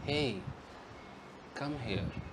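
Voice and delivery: calling someone tenderly, soft and low. Shown as a demonstration. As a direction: coax them close gently. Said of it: "नम्रता से कहा".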